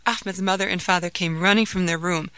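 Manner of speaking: plain reading voice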